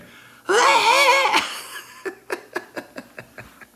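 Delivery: very high, loud imitated shriek breaking into laughter; Emily's screaming. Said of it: "she just starts screaming".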